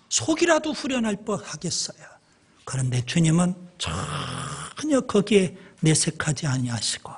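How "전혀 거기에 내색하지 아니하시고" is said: a sudden whispered pause in the sermon; whispered aside. Real, not acted. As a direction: drop to a whisper. Preacher drops voice mid-sentence.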